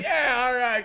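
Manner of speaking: high-pitched retold approval; proud mock cheer. Retold dad cheering the cussing.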